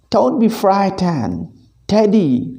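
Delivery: put-on calm the boy down like a kind father; reassuring father. Father reassures his son Teddy about the mongoose, gently. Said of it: "said his father".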